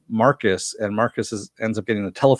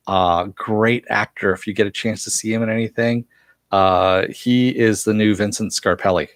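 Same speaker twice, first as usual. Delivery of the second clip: ordinary talk, nothing marked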